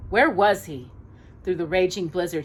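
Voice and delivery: ordinary delivery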